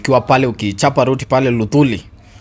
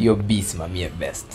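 low announcer aside; 5 semitones lower, 7 dB quieter than usual. Acted. Explaining slogan in announcer voice.

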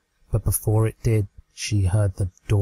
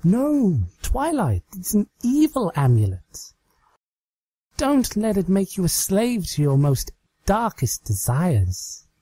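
mock terror; 9 semitones higher than usual. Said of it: "her eyes wide with fake terror".

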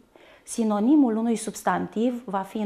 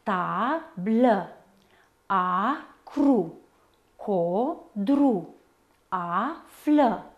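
deliberate syllable-by-syllable word list; despărțit în silabe; much slower than usual. Shown as a demonstration. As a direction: stretch the first syllable, pause before the next. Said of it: "Vom avea așa". (rostit rar, pe silabe)

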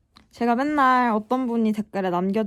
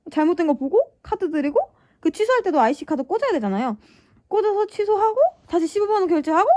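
higher, louder exasperation; exasperated rant; 6 semitones higher, 5 dB louder than usual. Real, not acted. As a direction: vent at her own forgetfulness. Frustrated rant about forgotten card.

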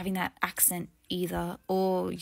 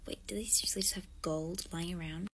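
amazed whisper; 4 semitones lower, 11 dB quieter than usual. Acted.

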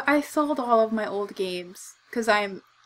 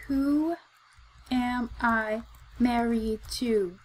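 slow, insistent repeat; 8 dB quieter, slower than usual. Real, not acted. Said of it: "You can ask me the same question a hundred times".

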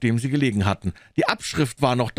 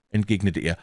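ordinary delivery

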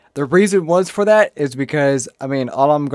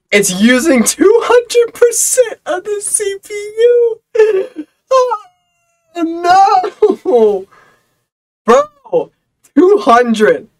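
panicked wailing; 7 dB louder, much slower than usual. Retold or imitated. Panic at server CPU.

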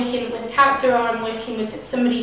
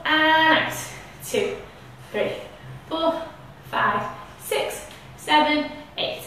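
slow guided breath count; 4 semitones higher, much slower than usual. Shown as a demonstration. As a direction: count the breaths calmly and slowly.

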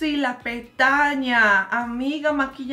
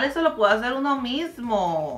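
ordinary talk, nothing marked

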